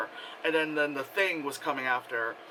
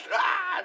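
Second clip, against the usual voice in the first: hissing shot into high mock scream; imitated shot and scream. Showing the three dots hitting people, mock scream. Imitating shots and victims' cries.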